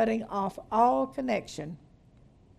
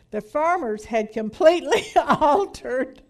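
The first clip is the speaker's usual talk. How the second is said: reading the line and cracking up mid-word; can't hold back the giggle, a bit louder; laughing while reading; 4 semitones higher, 7 dB louder than usual. Real, not acted. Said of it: "I'm sorry".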